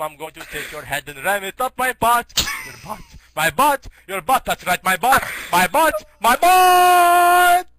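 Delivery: from loud to full shouting chant; building yell. Retold or imitated. Angry Pooh chants at Mac, building to a shout. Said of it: "getting mad".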